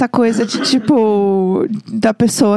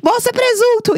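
mock alarmed yell; 12 semitones higher than usual. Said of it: "Eu dei um grito".

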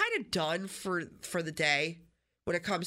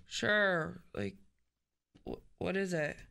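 unenthused; 2 semitones lower, slower than usual. Retold or imitated. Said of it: "couldn't be less enthused".

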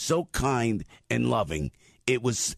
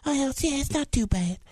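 much higher, chirpy lady voice; lady with the bad foot. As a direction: mimic the cheerful woman, voice pitched way up.

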